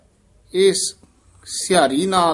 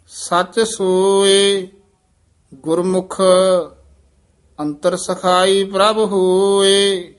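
measured, chanted scripture reading; scripture recitation with long pauses; slow Gurbani recitation; 4 semitones higher, 7 dB louder, slower than usual. Shown as a demonstration. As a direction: recite each phrase slowly and devoutly. Teacher chants Gurbani path.

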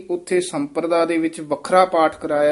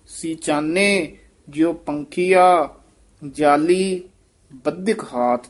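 slow ਸੀਚਾਨੇ ਜਿਉ ਪੰਖੀਆ, then a pause after ਜਾਲੀ; deliberate slow recitation; slower than usual. Shown as a demonstration. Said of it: "ਜਾਲੀ ਤੇ ਯਮਕ ਲਾ ਦੇਣੀ ਹੈ".

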